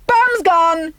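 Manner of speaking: high, gleeful shout; gleeful bombing call; triumphant cry. Acted. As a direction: call it like a bomber pilot. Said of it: "she cried".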